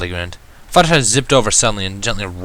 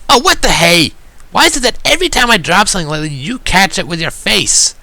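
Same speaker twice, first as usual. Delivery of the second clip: high, biting sarcasm from Rainbow Dash; mock her with sarcasm, pitched up; sarcastic; 10 semitones higher, 4 dB louder than usual. A narrator's reading. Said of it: "dripping with sarcasm".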